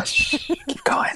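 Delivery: whispered shush; soft, slow whisper to carry on; quiet. Real, not acted. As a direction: hush them and whisper the prompt. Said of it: "I'll be quiet now".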